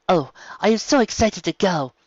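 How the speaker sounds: excited. Acted. Zebra Mizungu dreaming of seeing the world, excited. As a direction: burst with excitement about leaving. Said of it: "positively bristled with happiness".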